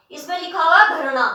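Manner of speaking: ordinary delivery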